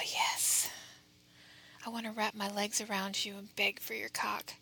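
close, breathy whisper right at the ear; whispering into your ear. Acted. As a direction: whisper it straight into their ear. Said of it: "whispering into your ear like this".

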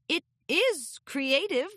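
slow, careful stately voice; regal princess. Acted. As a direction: choose your words politely. Luna unsure how to react.

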